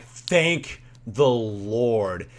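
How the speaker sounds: very slow, drawn-out thanks; mock heartfelt relief. Stranded family offered money, drawn-out relief. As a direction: stretch each word out in relief. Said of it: "we were just so thankful".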